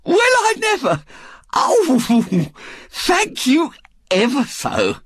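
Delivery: very high, thrilled woman's voice; ecstatic. A narrator's reading. Missus Bott thanks the young man, ecstatic. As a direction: gush with astonished gratitude.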